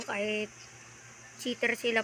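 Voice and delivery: ordinary delivery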